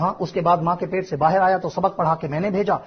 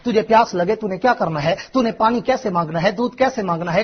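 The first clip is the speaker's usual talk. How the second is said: usual speaking voice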